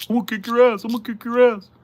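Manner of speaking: very high, loud squeal of a put-on aggressor; hammed-up high-pitched threat; mock threat voice. Streamer mimicking someone threatening to kick your ass, high mocking voice.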